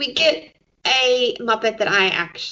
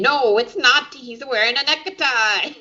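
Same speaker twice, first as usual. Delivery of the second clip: mock Italian accent, hammed-up; a deliberately bad Italian accent; 5 semitones higher, 3 dB louder than usual. Host imitating Kermit's bad Italian accent. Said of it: "in a really bad Italian accent".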